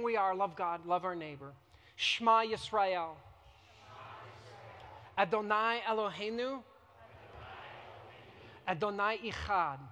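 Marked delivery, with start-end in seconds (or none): 2.0-9.8 measured liturgical recitation in Hebrew; prayer leading. Shown as a demonstration. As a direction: lead the Shema line by line. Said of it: "You can repeat after me".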